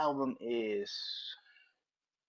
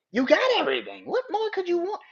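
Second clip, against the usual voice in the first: incredulous rant; 6 dB louder than usual. Real, not acted. Ranting at [name].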